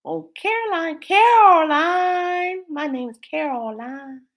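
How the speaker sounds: eerie stretched-out mother's call; creepy movie mom. Retold or imitated. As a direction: croon the name like a creepy mother. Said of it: "the artificial mom in the movie Caroline".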